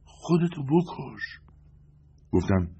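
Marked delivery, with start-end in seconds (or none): 0.0-1.2 faint smile. Acted. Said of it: "لبخندی محو زد و گفت".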